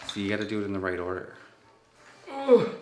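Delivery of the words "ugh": loud strained grunt heaving a weight; effort grunt. Real, not acted. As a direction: grunt as you lift. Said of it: "this piece is really quite heavy too".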